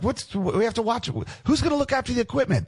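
high, fast pleading whine; mock begging. Band begging the label staff to stay, high pleading whine.

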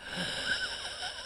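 sharp high gasp of overwhelm; big gasp. Retold or imitated. Recalling the sleepless night with a gasp. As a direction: suck in air all at once.

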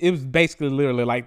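normal talking voice

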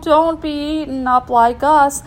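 high, loud ghostly wail; spooky ghost.

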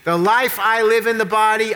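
ordinary talk

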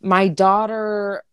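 normal talking voice

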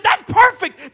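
God's joyful approval, shouted high; loud. Acted. Preacher voicing God to the congregation, loud and exultant. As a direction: cry it out like a triumphant preacher.

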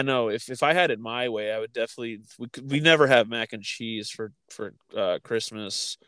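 normal talking voice

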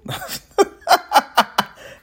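airy high laugh; breathy laugh. Real, not acted. Laugh at quote.

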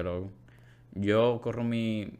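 ordinary delivery

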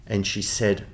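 normal talking voice

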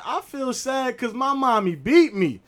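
put-on high, slow child confession; little girl. Neffy tells the class why she is sad, high and slow. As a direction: say it slowly like an innocent preschooler. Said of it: "and Neffy like".

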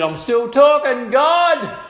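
loud, high taunt hurled at God; imitated defiant shout. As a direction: act out a scoffer yelling up to heaven. Imitating blasphemer taunting God aloud.

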